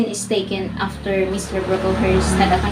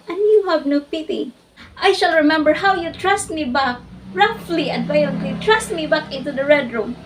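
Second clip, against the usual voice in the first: loud, higher-pitched angry outburst, easing slightly at the end; impassioned accusation; 9 semitones higher, 6 dB louder than usual. Acted. Jane Eyre tells her aunt she has no pity, loud and impassioned. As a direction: let the hurt burst out forcefully.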